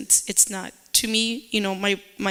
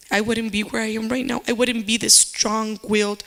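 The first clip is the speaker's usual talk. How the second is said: ordinary talk, nothing marked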